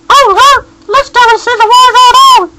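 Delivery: very high, small squeaky voice; tiny high squeak. A narrator's reading. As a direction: speak like a bear shrunk to ant size. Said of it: "his voice was so tiny".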